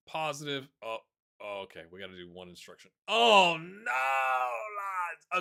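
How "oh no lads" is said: loud high cry of dismay; real frustrated shout at the players; anguished shout. Real, not acted. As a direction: yell it at the screen in despair. Reacting to a conceded chance.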